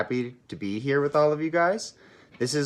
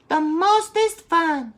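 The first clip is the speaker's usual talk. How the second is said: put-on much higher, slow, squeaky little-kid voice; tiny sister voice; 7 dB louder, much slower than usual. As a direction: stretch it out like a tiny kitten. Little sister kittens speaking.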